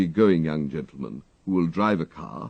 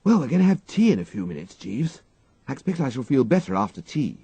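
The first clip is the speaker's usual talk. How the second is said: higher, quicker nervous gentleman; frayed young toff; 8 semitones higher, 4 dB louder than usual. A narrator's reading. Wooster tells his valet he hopes tea will revive him, nervous toff. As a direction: hurry it out, hoping for tea. Wooster voiced, far, fast.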